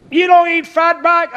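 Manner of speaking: high, loud challenging question; mock incredulous. Questioner challenging him about fat back, mock incredulous. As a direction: imitate someone aghast at a food choice.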